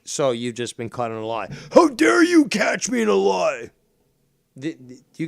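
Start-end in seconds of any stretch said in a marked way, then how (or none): 1.7-3.7 mock outrage. Mocking indignant shout.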